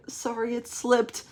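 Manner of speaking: put-on small, high, slow child voice; David. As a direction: apologise softly in a high kid voice. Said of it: "and David's like".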